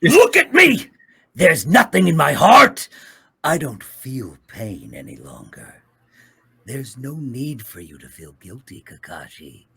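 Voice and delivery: intense anime villain voice; brooding, wounded rival speaking; Obito voice. Acted. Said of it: "to get Obito to tell people".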